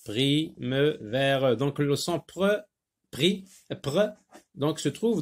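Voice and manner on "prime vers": "Prime-vers" very slowly split as pri, me, ve, re; syllable by syllable. Shown as a demonstration. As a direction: sound out "Prime-vers" with a gap after each syllable. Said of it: "On va sectionner".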